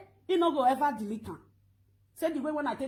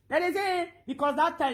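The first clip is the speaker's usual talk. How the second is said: ordinary delivery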